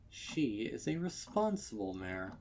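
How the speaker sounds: plain reading voice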